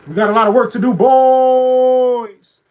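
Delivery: loud, high shout with the last word held long; rallying holler. Real, not acted. Streamer hollers rallying call to viewers.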